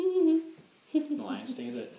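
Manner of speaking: high, slow put-on voice; mock disappointed. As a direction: drag it out, unimpressed. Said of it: "And I was like".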